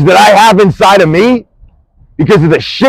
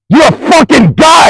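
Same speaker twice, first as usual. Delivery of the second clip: higher and shouting with contempt; furious shout; 8 semitones higher than usual. Real, not acted. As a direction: yell the insult. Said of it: "I am fucking angry".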